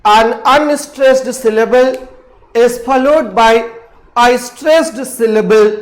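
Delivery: very slow, word by word dictation of "an unstressed syllable is followed by". Shown as a demonstration. Teacher dictating the iamb definition, slow and deliberate. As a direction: dictate slowly so students can write. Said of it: "जब एक unstressed syllable".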